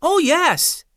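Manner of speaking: put-on high, bright child's reply; a boy answering eagerly; eager young boy. A narrator's reading. Douglas replying.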